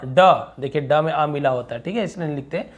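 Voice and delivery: normal talking voice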